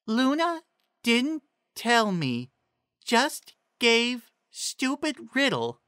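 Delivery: high, slow, simple-minded pony voice; high slow Ditzy. A narrator's reading. Ditzy reading Trixie's riddle to the group, high and slow. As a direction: drag it out high and dopey. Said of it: "Ditzy read".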